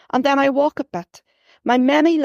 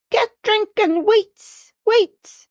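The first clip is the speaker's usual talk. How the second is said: put-on high, childlike, eager calling; small boy tugging his sister along; little boy voice; 10 semitones higher, much slower than usual. A narrator's reading. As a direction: babble like an excited toddler.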